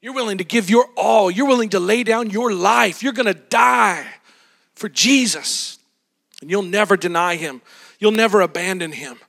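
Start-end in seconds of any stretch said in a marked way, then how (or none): none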